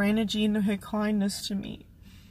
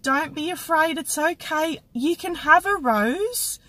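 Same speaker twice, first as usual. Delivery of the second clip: put-on higher, louder gentle reassurance to a scared child; kind old lady; 7 semitones higher, 3 dB louder than usual. Old lady calming a scared girl, gently reassuring. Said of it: "it was the first thing she said".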